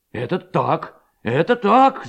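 rising from moderate to high cry; crowd shouting agreement. A narrator's reading. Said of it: "закричали в один голос все хлопцы". (дружный выкрик согласия)

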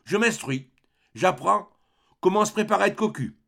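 a mildly voiced sneer, quickening at the end. A narrator's reading. Pierre sneers at his mother, mild mocking snicker. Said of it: "Il ricanait toujours".

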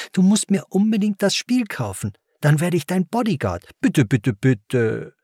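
put-on high, begging child's voice; urgent plea sliding down into repeated begging; pleading twelve-year-old. A narrator's reading. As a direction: beg insistently like a kid. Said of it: "flehte der Zwölfjährige inständig".

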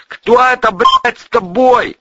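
loud, high, slow holler; suspicious sentry's yell; shouted inquiry. Acted. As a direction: demand it from the barricade. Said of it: "поинтересовались сверху".

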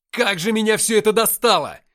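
high, full-force yell of frustration; exasperated shout. A narrator's reading. Narrator at breaking point yelling at heaven, exasperated shout. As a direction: shout it to the sky, fed up. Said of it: "крикнул я".

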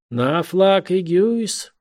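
stiff, slow barked order; drawn-out naval command; отрывистая команда. A narrator's reading. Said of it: "отрывистые слова команды". (протяжная отрывистая команда)

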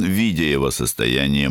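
plain reading voice

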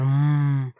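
drawn-out relishing hum; dreamy hum. A narrator's reading. Savoring the thought of a girl.

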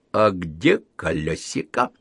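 slow, sinister interrogation; sterner. Acted. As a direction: demand it like a dark lord. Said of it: "спросил ещё строже". (ещё строже, медленно)

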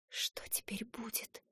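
hushed unvoiced whisper. Heroine whispering in shock after news, whispered. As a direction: breathe the question out. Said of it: "прошептала я".

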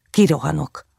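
usual speaking voice

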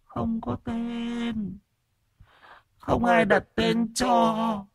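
very high, slow, faint ghost voice; tiny, thin voice of a dead infant's ghost; whimpering ghost child. A narrator's reading. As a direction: whimper it out like a lost child spirit. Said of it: "một hồi mới mếu máo".

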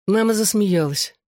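ordinary delivery, nothing marked

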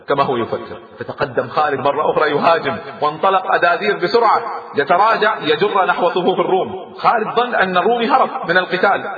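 ordinary delivery

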